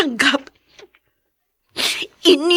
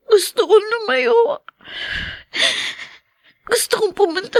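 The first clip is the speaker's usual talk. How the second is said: tearful; 6 semitones higher than usual. Acted. Daughter wishing to run away.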